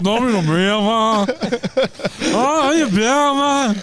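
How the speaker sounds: high, drawn-out bad Irish; hammed-up slurred Irish impression; mock Irish accent. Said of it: "Sound like a deaf Irish".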